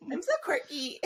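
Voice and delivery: high mock quirky girl again, laughing. Friend repeating the quirky girl mock, high and cutesy.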